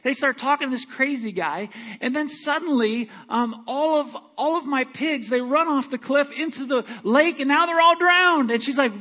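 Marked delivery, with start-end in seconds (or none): none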